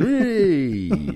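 high, drawn-out playful cheer; exaggerated celebration; mock cheer. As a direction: cheer it like a pantomime crowd. Mock cheer as internet returns.

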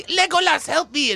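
high, mock-desperate call; cry for help. Host imagining a film scene, mock cry for help. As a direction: call out to a hero for rescue. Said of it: "someone would say".

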